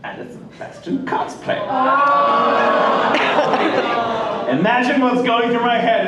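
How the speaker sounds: normal talking voice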